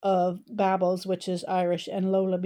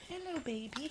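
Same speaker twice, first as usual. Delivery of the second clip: higher, much softer sweet coo; tender greeting; 7 semitones higher, 14 dB quieter than usual. Real, not acted. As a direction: coo softly to a tiny bird.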